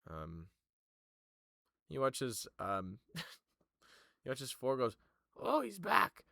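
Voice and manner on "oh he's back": high, bright-eyed surprised cry; much higher, delighted he returned; Four's voice. Acted. GM voices Four.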